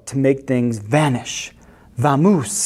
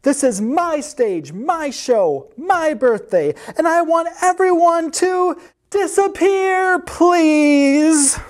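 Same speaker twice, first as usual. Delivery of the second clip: high whining outburst building to a wail; childlike high-pitched tantrum; 12 semitones higher, slower than usual. A narrator's reading. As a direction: climb higher into a drawn-out wail. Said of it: "he's having a tantrum".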